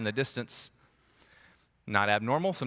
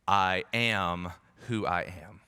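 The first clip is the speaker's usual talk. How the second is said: solemn slow God voice; 7 semitones lower, much slower than usual. Retold or imitated.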